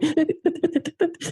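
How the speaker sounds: a bright high burst of laughter. Real, not acted. Laughing mid-story to a fellow guest. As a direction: let a real laugh break out high. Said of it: "started laughing".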